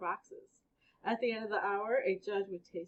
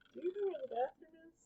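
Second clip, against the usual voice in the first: put-on higher, timid kid question; lightly voiced nervous boy; Aaron speaking up; 8 semitones higher, 4 dB quieter, faster than usual. A narrator's reading. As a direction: ask it shyly, off-topic. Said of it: "found the courage to speak up".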